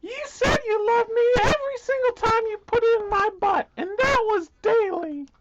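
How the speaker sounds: very high, wailing protest; hurt, squealing Bindi. Acted. Bindi protests that Russell said he loved her, high wail. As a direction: squeal the accusation like a wronged lover. Plays Bindi in lovers' quarrel.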